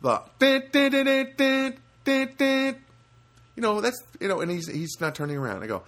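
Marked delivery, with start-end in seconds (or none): 0.0-2.8 high, rhythmic car-horn beeps; mimicked horn pattern; imitated car honking. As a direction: beep out a car horn on one high note. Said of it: "I start honking the horn".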